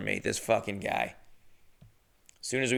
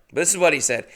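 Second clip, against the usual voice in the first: ordinary talk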